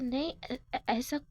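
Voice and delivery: stumbling nervously over the first syllables; stammering. Acted. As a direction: trip over ऐ- before ऐसा, as if caught off guard. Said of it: "आप इतना हकला रहे हो". (घबराकर हकलाते हुए)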